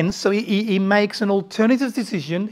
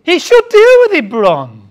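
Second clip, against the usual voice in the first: loud echo; 6 semitones higher, 9 dB louder than usual. Retold or imitated. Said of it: "echoing a congregant's remark".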